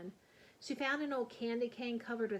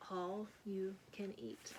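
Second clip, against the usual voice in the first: slow, much softer reading; hushed reveal; 2 semitones lower, 8 dB quieter, slower than usual. A narrator's reading. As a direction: let it land softly, slowly. Sign read in hush.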